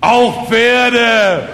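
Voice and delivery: high, loud, long-held proclamation; proud conviction. Acted. Speaker confirms horses die too, proudly proclaiming. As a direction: ring it out with total conviction. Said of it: "stolz im Brustton der Überzeugung".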